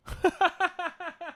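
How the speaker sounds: soft high staccato laugh; high giggle. Real, not acted. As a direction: run of quiet high-pitched giggles. Laughs after hoping movie is good.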